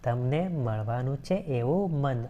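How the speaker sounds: verse line read slowly and carefully; slow down so students catch the rhyme; slow recitation. Shown as a demonstration. Teacher re-reading the verse for students, slow recitation. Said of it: "શું કહે છે?".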